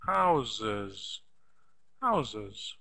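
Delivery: Houses said with a buzzing z, haʊzɪz; Houses, z in the middle, falling; z sound. Shown as a demonstration. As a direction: give the middle of Houses a clear z. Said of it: "Z sound".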